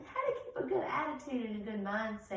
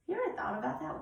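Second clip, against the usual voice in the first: ordinary talk, nothing marked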